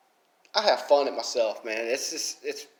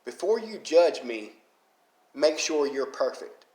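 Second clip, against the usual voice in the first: slow and weighty saying; a firm, measured motto; slow, deliberate quote. Shown as a demonstration. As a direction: lay the saying down slowly, word by word. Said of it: "I've got a saying for you".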